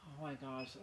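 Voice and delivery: ordinary talk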